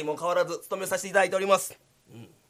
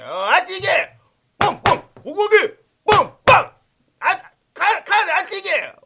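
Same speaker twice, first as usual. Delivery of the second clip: higher and louder, shoo-away shouting; drunken yelling; 10 semitones higher, 10 dB louder than usual. Acted. Drunk guard yelling at the samurai he thinks is a dog. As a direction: bellow at the dog outside.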